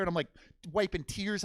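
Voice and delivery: ordinary talk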